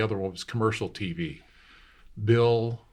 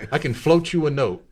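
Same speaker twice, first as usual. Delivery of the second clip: higher, louder generous merchant; shopkeeper voice; 6 semitones higher, 6 dB louder than usual. Retold or imitated. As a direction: offer the credit.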